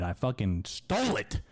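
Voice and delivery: ordinary delivery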